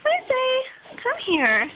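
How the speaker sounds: high sing-song call to the dog. Real, not acted. Calling the dog.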